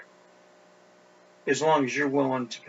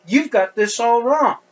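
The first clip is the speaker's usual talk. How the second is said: louder, higher exasperated protest, drawn out toward the end; indignant rebuke; 9 semitones higher, 9 dB louder than usual. Acted. Preacher paraphrasing Jacob's angry answer to Rachel, indignant and loud. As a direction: push back hard in a raised, indignant voice.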